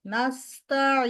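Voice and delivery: নাস্তাইন with a long nasal hum held; drawn-out ghunnah. Shown as a demonstration. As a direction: stretch the nasal in নাস্তাইন slowly. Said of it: "যেমন নাস্তাইন". (নাস্তাইন-এ লম্বা গুন্নাহ টেনে পড়া)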